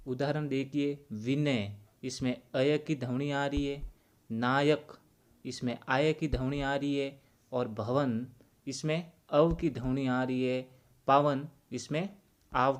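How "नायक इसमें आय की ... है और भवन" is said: ordinary delivery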